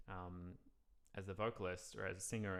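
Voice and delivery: ordinary talk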